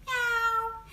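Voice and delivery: high mewing meow; Chinese cat meow. Shown as a demonstration. Showing viewers the Chinese cat sound, high mew. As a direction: mew like a kitten. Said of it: "we say".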